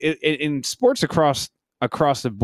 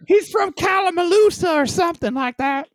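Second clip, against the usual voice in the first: silly put-on accent; 11 semitones higher than usual. Co-host explaining where the player's from, silly high accent. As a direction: keep the goofy high voice going. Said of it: "I don't know that accent".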